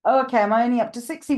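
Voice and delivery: ordinary talk, nothing marked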